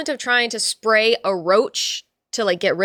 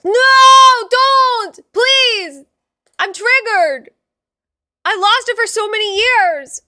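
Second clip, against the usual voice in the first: hammed-up high shouted protest; mock distress; 12 semitones higher, 11 dB louder, much slower than usual. Streamer protesting the chat's joke, hammed-up shouting. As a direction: wail like you've been robbed, playfully.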